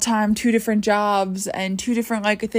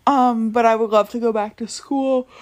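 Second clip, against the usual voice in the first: words stretched through a real yawn; mid-yawn, mouth wide open; 3 semitones higher, 3 dB louder than usual. Real, not acted. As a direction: talk right through a big yawn. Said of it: "Sorry for the big yawn".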